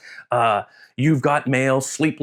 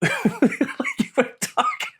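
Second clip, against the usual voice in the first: laughing. Real, not acted. Laughs at own rambling.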